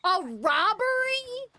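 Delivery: high, loud, slow incredulous gasp; mock shocked question. Streamer pretending shock at being called a robber, mock outrage. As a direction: act scandalized at the idea.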